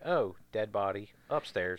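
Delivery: low, slow, soft deadpan; note it as if it were nothing; offhand mock nonchalance; casual, unbothered. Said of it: "how they casually just walk in".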